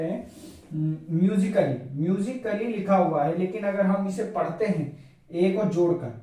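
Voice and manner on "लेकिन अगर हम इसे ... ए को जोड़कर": normal talking voice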